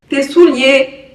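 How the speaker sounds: slow, drawn-out mock Québécois accent. Repeating the Québécois word souliers, amused imitated accent. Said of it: "Un Québécois qui te parle français".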